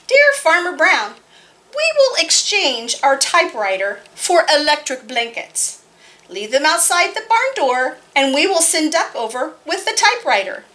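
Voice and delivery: put-on lively read-aloud for kids; story narration. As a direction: tell it brightly to a room of children. Reads picture book aloud.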